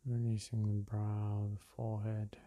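unhurried meditation-guide voice with long pauses; guided meditation. Real, not acted. Meditation guide leading listeners through breath and release, calm and unhurried. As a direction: guide them gently through each breath, leaving space between phrases.

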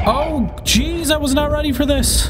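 high, panicked rush; being chased. Real, not acted. Chef chasing her in game.

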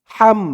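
Arabic ḥa in হাম; throaty h. Shown as a demonstration. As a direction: push the h of হাম from inside the throat, thin. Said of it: "হার আওয়াজটা গলার ভিতর থেকে চিকুন হয়ে বের হবে".

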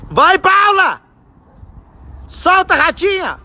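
high excited cheering. Real, not acted. Dad cheering his daughter flying her kite, high and excited. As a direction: urge her on like a fan.

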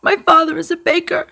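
put-on high, tearful little-boy voice; between sobs. A narrator's reading. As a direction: act a boy talking through sobs. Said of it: "he explained between sobs".

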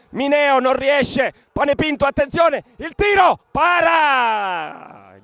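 fast running match commentary; live play-by-play. Acted. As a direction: call the action as it happens.

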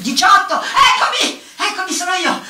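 high, loud, startled reply; eager calling out. Acted. Customer answering when number eighteen is called, high eager shout. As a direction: call out to claim your turn.

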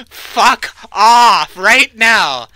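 slow, loud, drawn out; fierce command. Real, not acted. Player warning the pizza oven demon away, slow and loud. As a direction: order the demon out, word by word.

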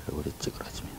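breathy whispered repeat. Real, not acted. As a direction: whisper it to yourself.

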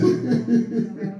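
high, soft giggle; short run of high chuckles; real light laugh. Real, not acted. Laughs at his own remark.

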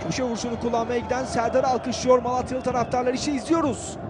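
normal talking voice

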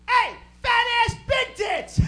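high, drawn-out holler; yelled heckle. Retold or imitated. Brother yelling at people leaving the store, loud heckle. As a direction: yell it slowly across a parking lot. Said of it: "You yelled stuff at the other people".

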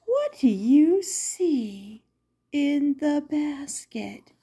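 hushed, breathy question; whispered question to the children; suspense whisper. A narrator's reading. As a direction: draw them in with a secret.

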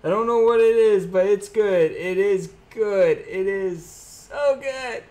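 high, drawn-out excited shout; excited outburst. Real, not acted. Card opener to viewers on glimpsing a good card, excited. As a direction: call it out high and loud, stretching the words.

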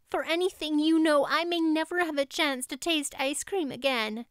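put-on girl begging Marilla to let her go to the picnic; desperate pleading. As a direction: beg as if everything depends on it. Anne begs to go.

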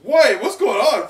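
very high, loud and slowed; confused surprise. Real, not acted. Reader can't follow the page, very high and loud. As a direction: blurt out in confusion.

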